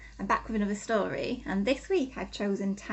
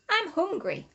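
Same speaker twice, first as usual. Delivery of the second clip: mild hungry badger, higher; a touch of a peckish badger; lightly voiced hungry badger; hungry Pete; 10 semitones higher, slower than usual. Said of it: "he thought".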